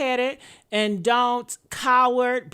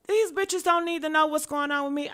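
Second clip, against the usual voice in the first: ordinary talk, nothing marked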